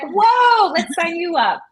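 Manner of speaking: loud, high, excited whoa; excited reaction. Retold or imitated. Choir teacher reacting to a surprisingly good kid, excited. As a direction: burst out with delighted amazement.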